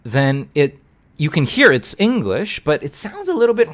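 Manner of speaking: sing-song, jumping pitch through "you can hear it's English"; misplaced music on "it sounds a little bit"; strange speech melody. Shown as a demonstration. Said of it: "the music is a little bit strange".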